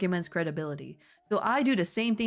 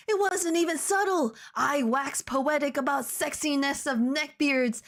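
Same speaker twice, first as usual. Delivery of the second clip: high protest; 7 semitones higher than usual. Acted. Voldemort protests.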